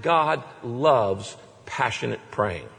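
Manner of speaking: normal talking voice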